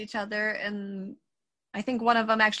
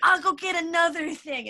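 ninja pup voice; 6 semitones higher than usual. Acted.

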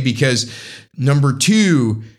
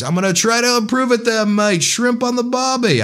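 high, hammed-up Aussie catchphrase voice; exaggerated mock-Australian; improved Australian accent; 10 semitones higher than usual. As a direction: have another go at a fake Australian accent, pitched high. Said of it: "your Australian accent gets better".

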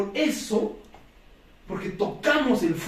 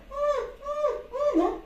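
high whiny puppy whimpering; high nasal-free mock puppy whine, hummed; dog whimper; 5 dB quieter, much slower than usual. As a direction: whimper like a hurt little dog. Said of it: "algo así dice el perrito".

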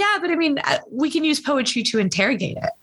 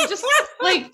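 higher words broken by laughter; laughing; 10 semitones higher than usual. Real, not acted. Joking to her guest about soap operas, laughing. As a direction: giggle through it.

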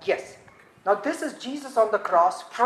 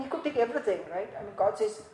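ordinary delivery

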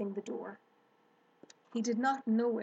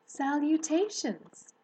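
thin voice; 5 semitones higher, 4 dB louder, slower than usual. A narrator's reading. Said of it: "repeated the voice".

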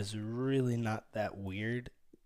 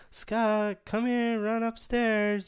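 high, loud mock diva calling out; hammed-up pleading from the bath; dramatic plea; 9 semitones higher, 5 dB louder than usual. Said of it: "that's a little dramatic".

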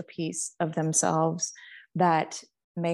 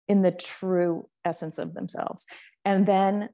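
ordinary talk, nothing marked

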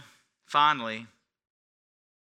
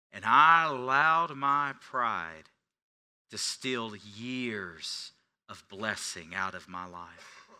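slow, heavy emphasis; 2 semitones lower, 5 dB louder, slower than usual. Acted. Slow, weighty sermon reflection.

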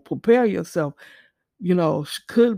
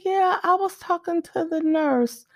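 put-on higher, light little-girl voice; 9 semitones higher than usual. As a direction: speak like a small sick child. Little daughter voiced in story.

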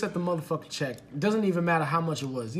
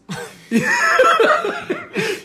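loud, high cackling laugh; burst of laughter; 7 dB louder than usual. Real, not acted. Telling friends about the date missing his mouth, high loud laughing fit. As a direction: crack up helplessly. Storyteller cracks up at own story.

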